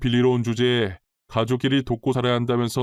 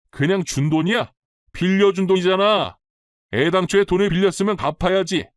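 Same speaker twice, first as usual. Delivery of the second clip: higher, louder-edged reproach; angry insistence; 5 semitones higher, 4 dB louder than usual. A narrator's reading. Mother-in-law insists the money was a loan, angrily. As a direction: hammer home that she owes you.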